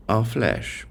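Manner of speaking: usual speaking voice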